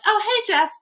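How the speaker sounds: delighted high greeting. Real, not acted. Greeting a viewer by name.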